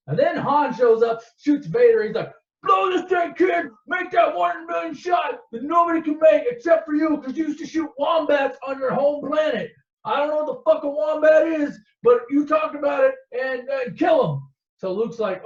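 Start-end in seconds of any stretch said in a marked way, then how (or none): 2.6-14.4 high-pitched, loud hollered urging; urgent yelling. Retold or imitated. Han cheering Luke on to shoot, shouting. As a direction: holler it like a hammed-up battle cry.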